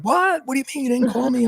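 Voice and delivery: very high, fast shocked questions; high-pitched retold disbelief; freaking out. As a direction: imitate an alarmed wife, voice shooting up. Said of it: "She was the same thing".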